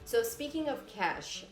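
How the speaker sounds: usual speaking voice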